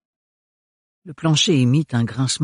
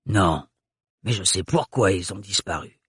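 put-on low guttural accented voice; guttural old woman; 5 semitones lower than usual. A narrator's reading. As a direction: answer low and knowing. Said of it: "avec son accent très caractérisé".

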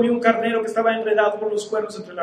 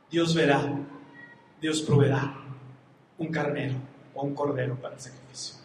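low, softened words of trust; quiet, low confidence, fading softer; solemn reassurance; 8 semitones lower, 8 dB quieter than usual. Acted. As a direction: reassure a child gravely, voice dropping away. Abraham solemnly reassures his son.

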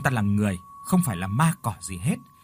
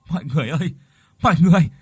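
raised, hurried call to the crowd; urgent shout; 6 semitones higher, 6 dB louder, faster than usual. A narrator's reading. Lựu calls the searching neighbours, urgent and loud. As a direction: call them back before they leave. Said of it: "Cô cất giọng gấp gáp hét lên".

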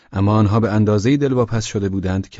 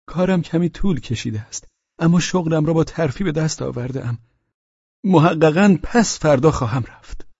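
high-pitched, eager character voice; Pavel Pavlovich; 8 semitones higher than usual. Acted. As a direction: pitch it well up, explaining himself. (صدای زیر و پرشور شخصیت داستان)

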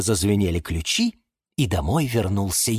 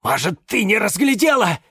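high, anxious questioning; pleading doubt in a high voice; insistent; 11 semitones higher, 4 dB louder than usual. A narrator's reading. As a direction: keep pressing, hoping for another answer. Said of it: "не унимался кактус Кирилл".

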